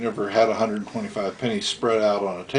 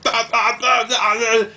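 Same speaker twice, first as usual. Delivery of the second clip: Kyle sputtering; 11 semitones higher, 4 dB louder than usual. Retold or imitated. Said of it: "and Kyle's like".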